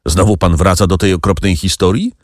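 ordinary delivery, nothing marked

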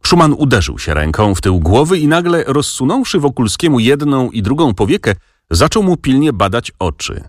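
ordinary delivery

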